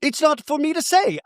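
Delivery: a light send-up of a coy reply; mimicking Bronwyn. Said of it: "And then Bronwyn says".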